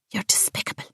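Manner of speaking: whispered venom; a whispered you're despicable to a traitor; hissed insult. Acted. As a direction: breathe the insult out with contempt.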